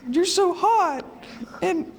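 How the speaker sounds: put-on high falsetto of swooning girls; mock high girly crush voice; fawning girls. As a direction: gush it like adoring fans, way up high. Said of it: "and they're all like".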